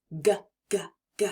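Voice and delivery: isolated hard g, short voiced throat sound. Shown as a demonstration. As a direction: repeat the bare g sound clearly. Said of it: "make the sound that the G makes".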